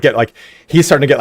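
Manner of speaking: usual speaking voice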